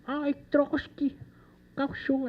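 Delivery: high, soft, drawn-out granny cartoon voice; vovó Juju. Speaker half-recalling the pilot's granny line for the audience, high soft granny voice. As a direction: try out a high, slow granny voice. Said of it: "como é que era?".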